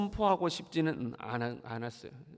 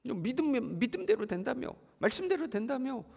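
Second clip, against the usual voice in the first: sneering jeer, pitched higher; mocking taunt; 7 semitones higher than usual. 선지자를 조롱하는 백성들, 높은 목소리로 비웃듯이. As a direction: scoff at the prophet as if daring him. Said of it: "하고 이제 자꾸 조롱하는 거죠".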